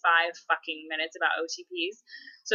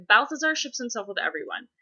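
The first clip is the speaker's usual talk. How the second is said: ordinary talk, nothing marked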